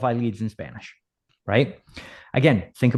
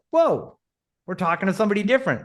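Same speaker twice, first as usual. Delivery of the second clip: mock-amazed "whoa", pitched way up, then quick; retold surprise; 8 semitones higher, faster than usual. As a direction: react as if startled by a stranger's voice. Retold family surprise at new voice.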